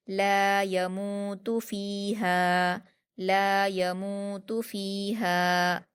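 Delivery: ला यमूतु फ़ीहा chanted slowly, long ला, मू, फ़ी and हा; stretched madd. Shown as a demonstration. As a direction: hold the long vowels of ला यमूतु फ़ीहा, य and तु short. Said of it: "दो हरकत खींचकर पढ़ेंगे".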